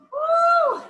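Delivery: excited high-pitched whoop. Real, not acted. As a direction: let out a big cheer. Cheering class on.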